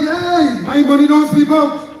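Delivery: swagger through his boast to mock him; hammed-up arrogant boast; mocking Keith Rowley. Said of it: "that is how he said it".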